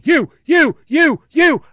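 loud, high chant of YOU; high-school taunt. Retold or imitated. Fans taunting the player who fouled, chanting loudly. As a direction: jab out each YOU like a heckler. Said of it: "like you used to do in high school".

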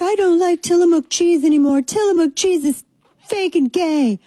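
very high whiny put-on voice; mock outraged complainers. Host mocking people who turned on a cheese brand, hammed-up outrage. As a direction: mimic angry boycotters in a silly high pitch. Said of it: "you're gonna be mad about this".

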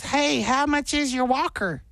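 put-on high old-lady voice. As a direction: play the sweet granny. Voicing sweet old Nana.

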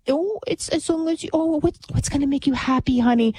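mock a mother sweet-talking her child; coddling liberal mom. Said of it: "she thinks".

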